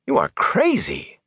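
very high, drawn-out disbelief; incredulous. A narrator's reading. Matt judging his friend mad, incredulous. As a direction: squeak it out slowly, utterly incredulous. Matt astonished at Rand's behaviour.

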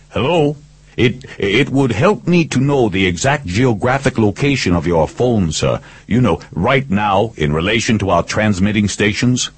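lightly put-on company engineer; engineer bluff. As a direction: coax information as a phone technician.

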